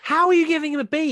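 loud, high-pitched outburst of disbelief; incredulous challenge. Real, not acted. Mock outrage at co-host's grade.

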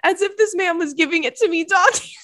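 high, loud, laugh-choked reading; squealing with amusement; barely holding it together. Real, not acted. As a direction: push the punchline out through giggles. Cracking up at the punchline.

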